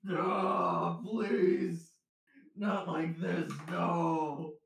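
mock panic, crying out no; hammed-up terrified cries. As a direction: ham up the horror of transforming. Cursed transformation acted out.

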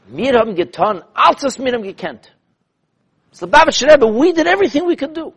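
rising to a high, loud, pleading cry; high, loud desperate pleading in Yiddish then English; desperate plea. Retold or imitated. Reb Chaim pleading with the Rebbe.

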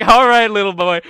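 projected host stepping in; cutting him off. Acted. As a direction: talk over the boy. [name] cuts off Bradley's speech.

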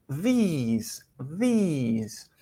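"these" with a long, smiling ee. Shown as a demonstration. Teacher introduces the plural, careful pronunciation model. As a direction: stretch the ee in "these", buzzed th first. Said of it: "decimos una I sonriendo".

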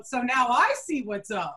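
slow, drawn-out knowing comeback; teasing throwback. Retold or imitated. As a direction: throw the phrase back with relish. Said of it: "that's what I said back to him".

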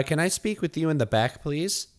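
usual speaking voice

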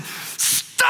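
short breathy mock yell of stop; mock shout. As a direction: bark out stop as a half-hearted retold scream. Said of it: "I just screamed and let that continue".

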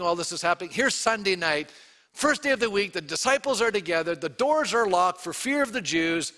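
ordinary talk, nothing marked

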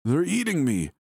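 high, terrified man's cry; wretched, pained cry. Acted. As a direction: cry out in pain and terror. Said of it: "His cries were wretched and pained".

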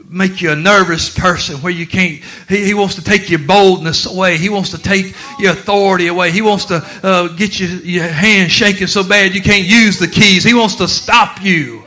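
loud, soaring preacher's cry; thundering pulpit call; full-force preaching. Acted. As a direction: preach it out to the back pews. Preaching builds again.

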